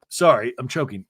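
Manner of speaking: ordinary talk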